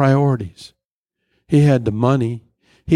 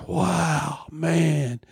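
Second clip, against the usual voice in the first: a gravedigger marvelling at the sight; awed worker; 4 semitones higher, much slower than usual. Retold or imitated. Worker admiring gold Cadillac burial.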